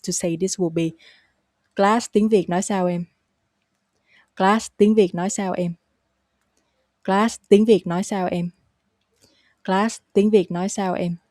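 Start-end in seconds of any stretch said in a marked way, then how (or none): 1.8-3.0 words run together at everyday speed; natural pace. Shown as a demonstration. Teacher demonstrates the question for learners, at natural pace. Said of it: "now the natural, uh, pace". 4.4-11.2 words run together at everyday speed; natural pace. Shown as a demonstration. Teacher demonstrates the question for learners, at natural pace. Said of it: "now the natural, uh, pace".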